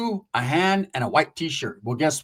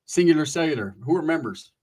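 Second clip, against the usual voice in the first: ordinary talk, nothing marked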